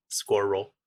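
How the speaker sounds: "squirrel" with both Rs hit hard; hard-R American "squirrel". Shown as a demonstration. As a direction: say "squirrel" as one rolled SKWURL. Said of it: "how hard the second R is hit".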